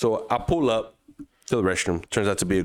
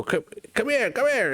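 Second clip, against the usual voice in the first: calling out. Retold or imitated. Vendor calling a friend over.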